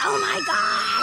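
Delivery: high, drawn-out shriek; sudden shriek. Real, not acted. Vlogger shrieking on a hot hill walk.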